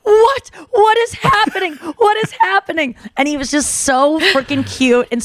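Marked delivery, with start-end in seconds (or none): 0.0-2.9 imitating his high, excited astonishment; overjoyed disbelief. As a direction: act out his giddy, incredulous reaction. Acts out his stunned, overjoyed reaction.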